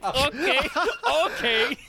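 giggling, high-pitched okay; squeaky laughing okay. Retold or imitated. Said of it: "I'm like".

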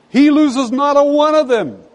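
high, ringing exclamation; fervent preaching. Real, not acted.

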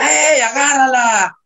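high mock yell from a new teammate; high imitated shout, slowed down; retold yell. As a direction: call it out like a striker mocking the keeper. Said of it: "y me grita".

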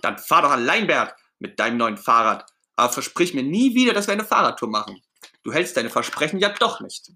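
high, loud sulky outburst; angry Ernie. Acted. Ernie telling Bert to ride alone, cross. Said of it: "Ernie ist jetzt richtig sauer".

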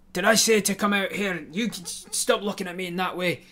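loud, high barking tyrant; tyrant with power. Acted. Bossy tyrant shouting orders at the prisoners. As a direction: lord it over the prisoners, shouting. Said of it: "really leaning into the, tyrant with power".

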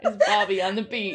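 laughing through a DJ call. Pretend. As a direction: hype it up like a radio DJ, giggling. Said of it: "That sounds like a D J".

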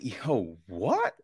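can't believe the excuse, voice climbing high; high, baffled "that makes no sense, homie"; incredulous. Retold or imitated. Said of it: "the explanation is just like".

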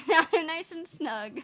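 laughing repeat. Retold or imitated. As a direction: echo it while laughing.

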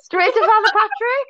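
very high, laughing incredulity; cracking up. Real, not acted. Telling friend about the exorcism suggestion, cracking up. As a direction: echo it in a squeal of amusement.

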